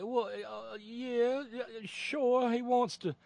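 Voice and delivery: very high, hammed-up Southern apologist; mock Southern accent. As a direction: parody of a fawning Southern pundit. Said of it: "doing this in a Southern accent".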